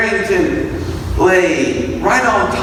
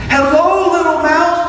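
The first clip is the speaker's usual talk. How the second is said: calling out; 10 semitones higher than usual. A narrator's reading. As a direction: call across the snow to the mouse. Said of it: "Barrington yelled".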